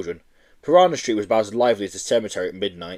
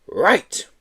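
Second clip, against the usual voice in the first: declaring; 8 semitones higher, 3 dB louder than usual. Acted. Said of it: "declared Miss Hatchet".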